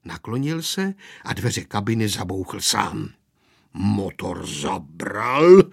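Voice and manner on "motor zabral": slow, low start swelling loud; revving-up narration. A narrator's reading. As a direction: drag it out like an engine catching. Narrator dramatises the engine revving. (protáhle, jako rozbíhající se motor)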